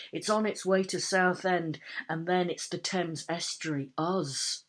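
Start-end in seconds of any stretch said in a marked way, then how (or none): none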